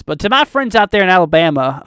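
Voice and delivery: hammed-up Southern accent. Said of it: "I said that in the most southern accent possible".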